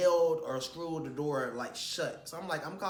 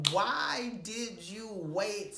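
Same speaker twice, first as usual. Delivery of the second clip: livid; 6 semitones higher, much slower than usual. Retold or imitated. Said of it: "I was so livid".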